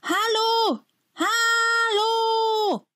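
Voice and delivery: very high, drawn-out calls for help; calling for help. A narrator's reading. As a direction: call out as far as you can through the window. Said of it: "Auch Mark fängt an zu schreien". (sehr hoch und langgezogen um Hilfe rufen)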